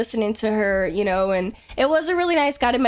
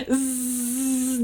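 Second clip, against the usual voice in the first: long, soft sustained buzz; imitated buzzing; 4 dB quieter than usual. Streamer copying the guide's buzzing exercise, long steady buzz. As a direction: hum a steady bee-like zzz through the teeth. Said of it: "do a buzzing sound".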